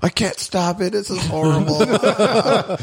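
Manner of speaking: high, drawn-out comic misery; mock anguished wail. Pig stuck in endless overload, mock anguished wail. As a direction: wail helplessly, hammed up for laughs. Acts out endless suffering, laughter.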